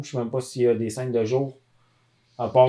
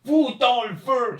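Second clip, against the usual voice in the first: high booming villain battle cry; Bernard Fortin villain voice; 11 semitones higher, slower than usual. Retold or imitated. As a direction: shout it like a cartoon villain dub. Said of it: "C'est lui qui faisait la voix du méchant".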